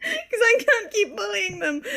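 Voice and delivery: high-pitched, laughing plea. Real, not acted. Player admitting they can't keep bullying characters, laughing. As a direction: squeeze the words out through laughter. Player cracking up mid-banter.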